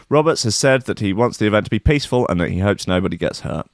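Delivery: normal talking voice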